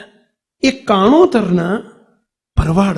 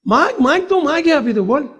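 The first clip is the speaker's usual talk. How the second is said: generous Bapa; 6 semitones higher than usual. Acted. Bapa offering a boon.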